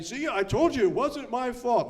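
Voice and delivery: mock vindicated whine; whiny excuse. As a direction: sound like a kid getting off the hook. Imagined self-excusing quote.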